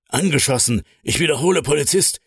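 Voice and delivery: high-pitched emergency report, not shouted; urgent alarm. A narrator's reading. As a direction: report it in rising panic at normal volume. Partner urgently reporting an officer shot.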